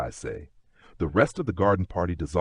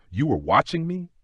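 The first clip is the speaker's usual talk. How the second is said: higher, questioning lilt with a touch of flirtation; flirtatious; 9 semitones higher than usual. A narrator's reading. Duchess to Lord James at the garden party, unintentionally flirtatious. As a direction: let the question slip out flirtatiously, pitched up. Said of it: "slip out more flirtatiously than you intend".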